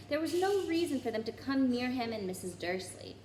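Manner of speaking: ordinary delivery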